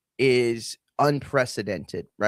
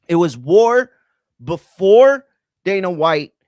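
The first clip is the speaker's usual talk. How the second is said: louder, slow and hammered home; emphatic; 3 semitones higher, 9 dB louder, slower than usual. Real, not acted. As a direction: pound the point, slowly. Emphatic rant point.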